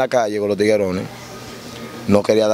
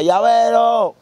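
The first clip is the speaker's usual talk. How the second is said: calling out; 11 semitones higher, much slower than usual. Retold or imitated. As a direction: yell for the turnkey across the cell block. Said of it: "El tipo empieza a vocear".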